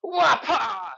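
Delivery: high, loud mock burst of eagerness; imitating an overeager exerciser yelping as the leg flies back; overexcited yelp. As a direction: jerk into it with a silly shout. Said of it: "we tend to get a little excited".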